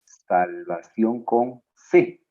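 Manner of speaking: Salvación con C spoken slowly, with weight on con; slow reminder. Shown as a demonstration. Teacher reminding students of the spelling, slow. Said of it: "Acuérdate".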